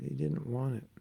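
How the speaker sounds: normal talking voice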